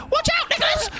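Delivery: high shriek of alarm; warning shout. Real, not acted. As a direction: yell out to warn a teammate. Warning teammate in game.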